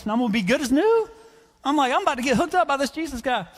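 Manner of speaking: high, building excited chatter; fired up. Acted. Simon expecting Jesus to heal his shoulder, fired up. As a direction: gush with rising hope, peaking at the end. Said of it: "I'm getting fired up".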